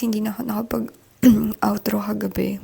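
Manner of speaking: usual speaking voice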